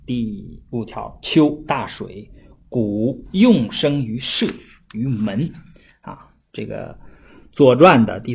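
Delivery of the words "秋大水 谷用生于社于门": slow, measured reading of ancient text; classical text recitation. Shown as a demonstration. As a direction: read each phrase slowly like reciting a classic. (缓慢吟诵古文)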